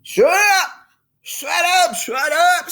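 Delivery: high, loud furious scream; Harry screaming at Melinda to shut up. A narrator's reading. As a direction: scream to drown her out. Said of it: "screamed Harry".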